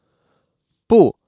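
不 slowly, high and falling steeply; a loud, drawn-out falling tone on 不; fourth tone. Shown as a demonstration. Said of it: "is in the fourth tone".

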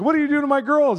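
high, loud mock challenge; imitated amused captain. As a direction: playfully demand an answer as the captain. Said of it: "He's laughing".

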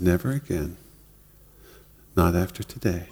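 soft, low-key promise; quiet. Retold or imitated. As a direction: keep it hushed and gentle. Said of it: "he said it that quietly".

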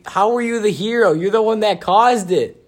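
incredulous shout. Real, not acted. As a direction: demand how he could be a hero. Arguing over who was hero.

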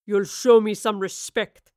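put-on stern captain puts the girl in her place; deep weathered captain. A narrator's reading. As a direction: let each word land like a weathered captain. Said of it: "in a deep voice as weathered".

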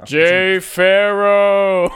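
booming, high, slow name callout; hammed-up late-night show intro call; SNL announcer voice. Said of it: "S N L voice".

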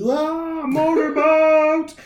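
high, drawn-out excited holler; over-excited heckler. Retold or imitated. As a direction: imitate a man getting far too excited. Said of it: "a little too aggressively excited".

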